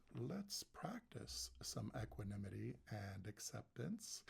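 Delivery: hushed mock-solemn whisper. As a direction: whisper it like a secret invitation. Playful hush before starting practice.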